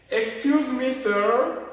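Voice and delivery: high and loud excuse me sir; polite attention call. Shown as a demonstration. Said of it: "C'est très capital ce que je vous montre".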